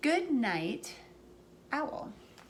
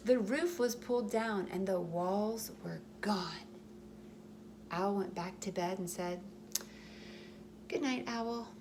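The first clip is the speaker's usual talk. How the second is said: gentle storybook read-aloud; reading to children; 4 dB quieter than usual. A narrator's reading. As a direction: tell it like a bedtime story for little ones. Reading picture book aloud.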